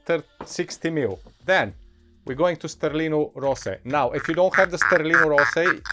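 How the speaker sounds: ordinary talk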